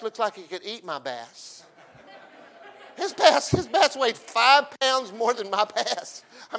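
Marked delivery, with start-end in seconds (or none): 3.0-6.2 laughing. Real, not acted. Laughing disbelief at son's bigger bass.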